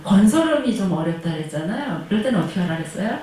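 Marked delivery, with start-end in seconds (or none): none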